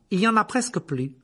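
lightly voiced woman, high and loud; woman's voice. A narrator's reading. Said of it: "dit une voix de femme".

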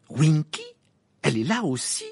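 surprised, rising question. A narrator's reading. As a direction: sound taken aback. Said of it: "s'étonna Harry".

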